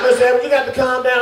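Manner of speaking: high, hurried mock pleading; urgent pleading, retold. Staff tell panicking patient to calm down, urgent retold plea. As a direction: act out flustered staff, pitched high and quick.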